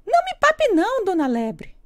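very high squeaky bun voice; little bun voice. Acted. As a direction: squeal like a tiny frightened bun. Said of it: "disse o bolinho". (voz bem aguda de bolinho)

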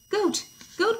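very high-pitched urgent call; acted hen summoning a friend; crying out. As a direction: call out in storybook alarm. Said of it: "cried Hen".

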